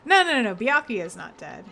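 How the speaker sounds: usual speaking voice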